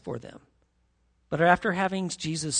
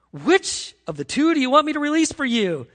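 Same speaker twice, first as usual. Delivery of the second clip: calling out; 7 semitones higher, 8 dB louder than usual. Retold or imitated. Pilate asks the crowd.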